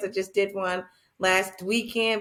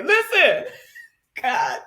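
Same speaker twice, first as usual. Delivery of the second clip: laughing exclaim; 5 semitones higher, 4 dB louder, slower than usual. Real, not acted.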